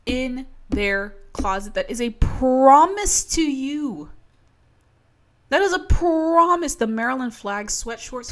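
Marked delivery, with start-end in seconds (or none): 1.8-6.8 emphatic promise. Real, not acted. Emphatic vow to viewers.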